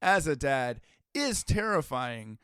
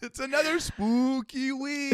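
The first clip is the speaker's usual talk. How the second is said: hammed-up spooky announcer; 11 semitones higher, 4 dB louder than usual. Co-host announcing another spooky week, hammed-up spooky wail. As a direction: wail it like a campy ghoul.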